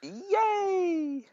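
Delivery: high, drawn-out little yay; mock cheer. Co-host told it was avoided just for them, mock delight.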